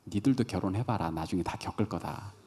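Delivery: low, quiet grumbled warning; muttered aside. Real, not acted. Pastor to unmarried listeners who will go through it, muttered aside. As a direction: drop it low, trailing off under your breath.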